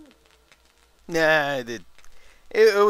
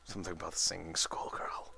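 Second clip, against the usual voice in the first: hushed, much softer muttered remark; whispered aside; 7 semitones lower, 13 dB quieter than usual. Real, not acted. Gamer murmuring to himself about the schoolgirl, whispered. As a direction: whisper it to yourself.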